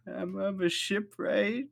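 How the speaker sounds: high slow sniffling answer; hammed-up crying girl, high and slow; teary squeak. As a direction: squeak it out between sobs. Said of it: "just ugly crying".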